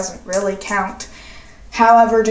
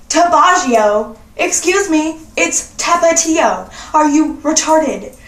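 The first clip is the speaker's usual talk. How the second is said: put-on correcting her boyfriend's pronunciation, sassy; scornful correction; 4 semitones higher than usual. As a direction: drag out Tabaggio in disbelief.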